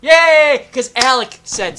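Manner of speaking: loud, high, drawn-out hooray; over-the-top cheer. Pretend. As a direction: cheer it loudly, stretching the words. Cheers the special episode.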